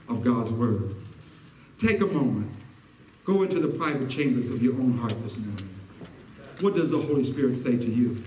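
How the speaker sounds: low, hushed preacher with long pauses; solemn altar call. Real, not acted.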